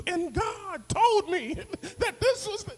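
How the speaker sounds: high, slow preacher voice; mock preaching. Comic preaching to the interviewer. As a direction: ham up a sermon mid-interview. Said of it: "I'm gonna start preaching in the interview".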